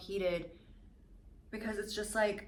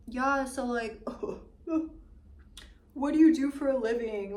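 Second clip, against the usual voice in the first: raised, louder bro voice asking what she does; mocking dude voice; 4 semitones higher, 5 dB louder than usual. Retelling the dispensary guys' question in a mock bro voice. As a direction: imitate the nosy guys, a bit higher and louder. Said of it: "were like".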